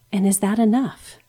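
usual speaking voice

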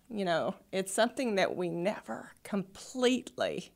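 trembling whisper fighting tears; shaky whisper. Real, not acted. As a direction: voice shaking, nearly a whisper. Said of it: "you can probably hear my voice shaking".

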